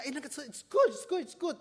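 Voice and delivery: high, loud and excited, gushing over the car; covetous admiration. Retold or imitated. Driver spotting a car he wants, gushing excitedly. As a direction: drool over a shiny car you just passed.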